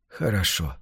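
low, soft, weary assent; quietly resigned and tired. A narrator's reading. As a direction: agree wearily, voice dropping. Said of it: "устало пообещал Вилли".

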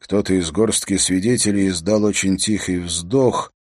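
plain reading voice

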